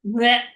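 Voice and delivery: short, loud blech; mock retching. Host mimics the possessed girl vomiting, loud mock retch. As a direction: imitate a quick spew. Imitating vomit after pea soup talk.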